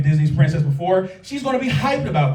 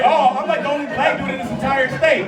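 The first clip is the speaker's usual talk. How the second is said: mocking quote; 6 semitones higher than usual. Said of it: "don't say".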